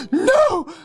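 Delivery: high mock shriek. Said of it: "gasps and cries out".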